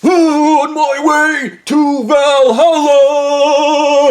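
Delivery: high, loud, slow sung-out war cry; Mad Max war boy. Retold or imitated. Speeding driver screams at the narrator, war boy chant. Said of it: "like a war boy from Mad Max".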